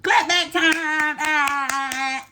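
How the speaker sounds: high, slow, stretched-out call; drawn-out segment announcement. Real, not acted. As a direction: sing out the segment title, holding the vowels.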